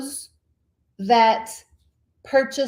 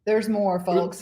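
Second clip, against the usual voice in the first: ordinary delivery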